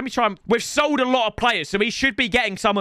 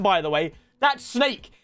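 ordinary talk

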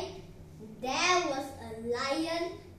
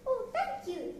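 high, little grateful mouse voice; high-pitched mouse thanks; squeaky mouse; 9 semitones higher than usual. Acted. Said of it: "squeaked the little mouse".

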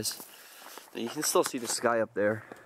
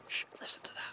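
hushed whisper, no voice; 12 dB quieter, much faster than usual. Real, not acted. Whispering to the camera while listening to the silence outdoors. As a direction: breathe it out so you don't break the silence. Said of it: "I almost feel like I should be whispering".

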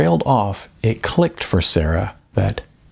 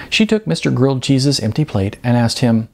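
plain reading voice

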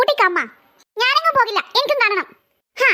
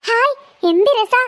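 gleeful relish; slower than usual. Acted. Sister relishing ghost movie.

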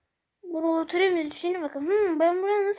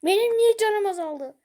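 loud, higher indignant question; indignant complaint; 5 semitones higher, 5 dB louder than usual. Real, not acted. Gamer protesting to the game about lost health, loud.

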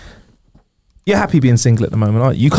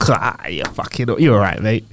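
put-on Irish accent; 5 semitones lower, 4 dB quieter than usual. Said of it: "I'll be like".

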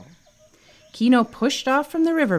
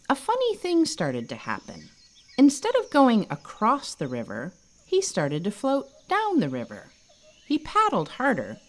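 ordinary delivery

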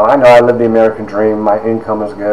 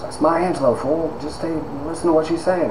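soft, higher-pitched mock reprimand; hushed scolding; 5 semitones higher, 11 dB quieter than usual. Colleague telling student to stay and listen, hushed scolding. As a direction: scold under your voice at the table.

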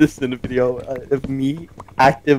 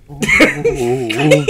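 cracked rib; 5 dB louder than usual. Real, not acted. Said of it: "It actually hurts to laugh".

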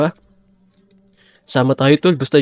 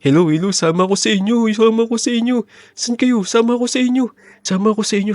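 panicky pleading; 9 semitones higher than usual. Acted. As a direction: yelp to join the group in mock panic. Panicked hider begging to join. (hysterical na pakiusap, mas mataas ang boses)

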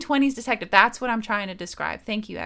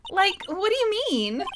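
put-on high questioning valley girl; man doing a valley girl; 8 semitones higher than usual. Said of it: "Like, Valley Girl's good for this".

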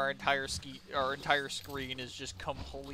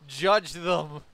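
JUDGE THEM said much louder; emphatic command; 9 dB louder than usual. Real, not acted. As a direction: belt out "Judge them" as an order.

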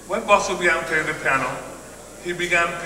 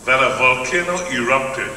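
usual speaking voice

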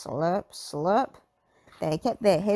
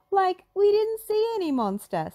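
Shaggy voice; 12 semitones higher than usual. A narrator's reading. Shaggy denying the monster, high and defensive. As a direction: deny it. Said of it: "said Shaggy".

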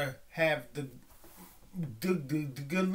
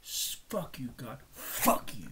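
bitter whispered curse at God; 3 semitones lower, 4 dB quieter, slower than usual. Real, not acted. Cursing God again, in a bitter whisper. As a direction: hiss the curse under the breath.

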